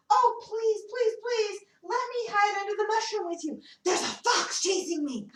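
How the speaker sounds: high, urgent begging; panicked rabbit. Acted. Chased rabbit pleads to hide under the mushroom, high and urgent. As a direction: beg fast, the fox is coming. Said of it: "comes running up to them and says".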